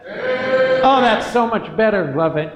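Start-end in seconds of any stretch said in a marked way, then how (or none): 0.1-0.8 congregation amen. Real, not acted. And everybody said.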